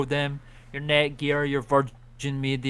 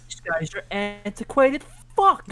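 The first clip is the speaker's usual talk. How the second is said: mocking voice; 8 semitones higher, 5 dB louder, slower than usual. Echoing kid mockery, voiced.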